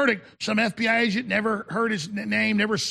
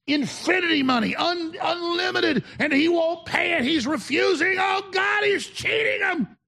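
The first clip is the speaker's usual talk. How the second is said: much higher, shouted parody of the media; mocking tabloid outrage; mock outraged headline; 8 semitones higher than usual. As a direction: ham up the scandal cry.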